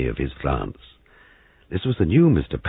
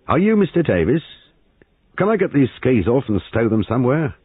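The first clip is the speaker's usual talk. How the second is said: high, raised voice with mild annoyance; slightly annoyed; 9 semitones higher, 5 dB louder than usual. A narrator's reading. Affronted young sergeant addressing his host, slightly annoyed. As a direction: ask briskly, a touch put out. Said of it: "said in a slightly annoyed tone".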